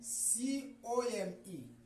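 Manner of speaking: सीओएमई spelled out letter by letter, very slowly; dictating the spelling of सीओएमई to a class. Shown as a demonstration. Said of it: "क्या लिखा है".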